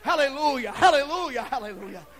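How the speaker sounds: loud, high ringing cries of praise; preaching at full force. Acted. Preacher rousing the church with loud hallelujahs. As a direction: shout praise out over the congregation.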